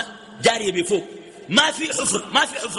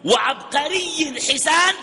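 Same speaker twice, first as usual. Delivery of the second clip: fervent shout; 8 semitones higher, 3 dB louder, much slower than usual. Real, not acted. Preacher exalts paradise carpets.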